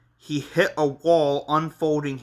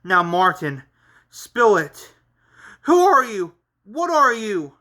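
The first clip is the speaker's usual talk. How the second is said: raised, demanding yell; angry yell; 6 semitones higher, 5 dB louder than usual. A narrator's reading. Henry confronting Martin for answers, angry yell. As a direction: demand answers, louder and higher. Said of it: "yelled Henry with anger".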